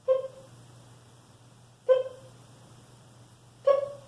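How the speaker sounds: tiny, very high peep; short squeaky chirps from a newborn duckling; hatchling chick. A narrator's reading. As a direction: peep like a just-hatched bird. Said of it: "struggled out of his shell and said".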